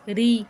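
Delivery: "ری" with a light, thin R. Shown as a demonstration. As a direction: keep the R of "ری" fine, not heavy. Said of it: "زیر والی را باریک". ("ری" باریک آواز میں)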